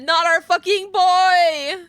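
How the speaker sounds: slow, loud lament. Real, not acted. Gamer laments the boy's death, slow lament. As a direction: plead against the loss.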